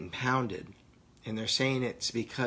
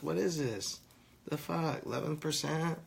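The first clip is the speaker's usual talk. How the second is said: 1 incredulous; 5 semitones higher, 3 dB quieter than usual. Real, not acted. Disbelief at low THC.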